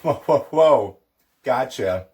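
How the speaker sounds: objecting learner. Acted. Puzzled learner interrupting the Irish teacher, objecting loudly. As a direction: halt someone mid-lesson with loud whoas. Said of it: "that's where you're like".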